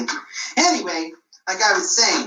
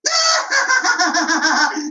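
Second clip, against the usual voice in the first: gloating laugh; 7 semitones higher than usual. Acted. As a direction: cackle at the fooled village. Boy gloats after second trick.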